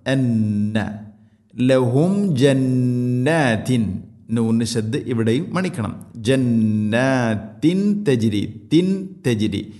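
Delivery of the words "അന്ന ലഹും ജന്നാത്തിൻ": അന്ന with a held nasal ന്ന; nasal ghunna. Shown as a demonstration. Teacher demonstrating ghunna on അന്ന. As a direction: hum the doubled nun in അന്ന. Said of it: "നൂന് ശദ്ദുണ്ട്, മണിക്കണം".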